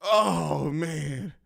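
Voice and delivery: ordinary talk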